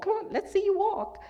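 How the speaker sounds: high, quick, cheerful coaxing; high encouraging mother voice; coaxing mom. Retold or imitated. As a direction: urge a toddler to take a step. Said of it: "what moms do with their children".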